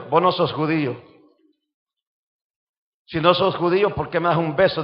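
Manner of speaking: low, soft thinking aloud; inner thought. Retold or imitated. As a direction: muse quietly to yourself. Inner thought retold quietly.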